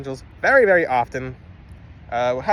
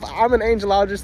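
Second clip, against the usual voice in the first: very happy; 5 semitones higher than usual. Real, not acted. Angelologist sharing a beloved topic with viewers, real happy excitement. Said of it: "I'm very happy about this topic".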